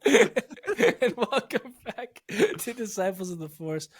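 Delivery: slow intro broken by real giggles; can't stop giggling while welcoming listeners back; laughing through the intro. Real, not acted. Host giggling through the podcast welcome, laughing. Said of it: "sorry for the giggles right in your ears".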